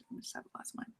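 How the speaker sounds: whispered so the husband won't hear. Real, not acted. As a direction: hush it down to a whisper. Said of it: "I can't whisper".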